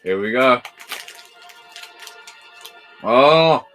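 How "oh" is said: ordinary delivery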